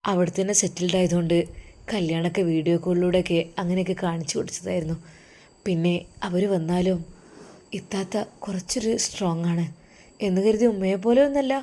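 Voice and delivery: husband quietly comforting his wife before going abroad; tender husband. Acted. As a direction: soothe her gently before he leaves.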